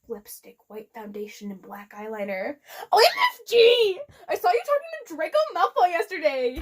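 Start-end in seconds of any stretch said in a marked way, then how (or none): 2.9-6.6 high, loud squeal of gossip; excited. Acted. Friend Willow gossiping about Draco, squealing excitedly. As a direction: shriek it like a giddy schoolgirl. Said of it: "she said excitedly".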